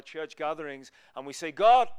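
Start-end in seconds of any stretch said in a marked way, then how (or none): 1.5-1.9 high, loud mock appeal; calling out to God. Believers at church gatherings addressing God, loud acted call. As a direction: call on God with sudden force.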